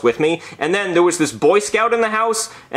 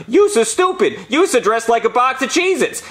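high, goofy alien taunt; mock him like Jar Jar Binks, pitched high; squeaky Gungan-style insult; Jar Jar Binks mockery; 8 semitones higher than usual. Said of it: "this nerd dressed like Jar Jar Binks starts making fun of me".

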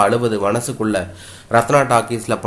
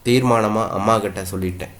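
ordinary delivery, nothing marked

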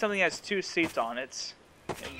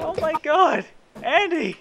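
excited; 10 semitones higher, 6 dB louder, slower than usual. Real, not acted. Getting shot back repeatedly.

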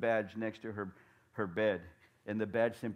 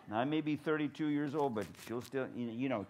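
normal talking voice

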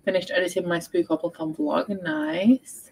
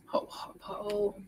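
soft whisper after a hot sip; 2 semitones higher, 13 dB quieter, much slower than usual. Real, not acted. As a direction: whisper it, tea too hot. Sipping very hot tea.

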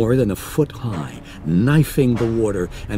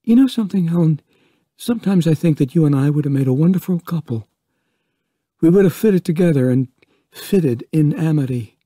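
higher, louder, hurried confession; Vaughn confessing; 6 semitones higher, 5 dB louder, faster than usual. Acted. Vaughn confessing his feelings to Ellen, higher and hurried. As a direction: pour it out fast, pitched up.

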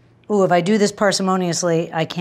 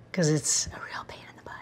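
conspiratorial whisper after a laugh; whispered aside; 4 semitones lower, 12 dB quieter than usual. Real, not acted. Cook confiding to viewers about thyme picking, whispered aside. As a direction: whisper it like a secret joke.

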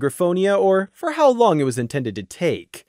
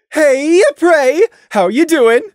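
bright, louder, higher chirp; chirpy Taffy; 9 semitones higher, 7 dB louder, slower than usual. A narrator's reading. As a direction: greet him like a cheerful old friend. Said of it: "Taffy chirped".

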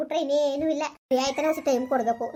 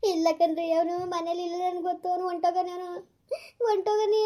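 rising, tearful insistence breaking into a sob; crying; 4 semitones higher than usual. Acted. Wife crying to husband that son has left. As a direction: sob out that he is gone.

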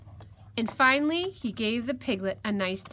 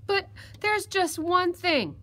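higher-voiced little piglet giving in; piglet, reluctant; 8 semitones higher, 5 dB louder, slower than usual. Piglet stalls the fox before roasting, meek and high. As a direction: give in haltingly in a high piglet voice.

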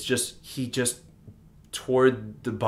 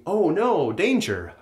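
raised-pitch, lightly acted "uh-oh" alarm; mock alarm; 7 semitones higher, 4 dB louder, slower than usual. Teacher acting out the story's danger, mock alarm. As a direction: play at sudden danger.

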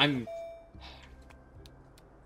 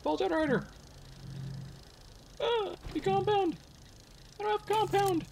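much higher, frantic searching voice; real high-pitched fluster; frantic high panic; 12 semitones higher, 3 dB quieter than usual. Real, not acted.

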